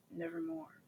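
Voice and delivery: high, soft eerie bird voice; thin ghostly croak; raven voice. Acted. Raven answering the man, high and eerie. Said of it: "when he says".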